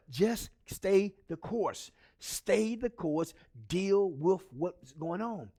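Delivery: slow, measured encouragement; slow right down, weighting each word; steadying exhortation. Real, not acted. Steadying the viewers after pep talk.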